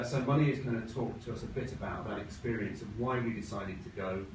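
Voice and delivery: ordinary talk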